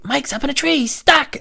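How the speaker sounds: very high, mock panicked cry; alarmed kids. Kids report a friend in a tree, high alarmed cry. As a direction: raise the alarm in a squeezed kid voice.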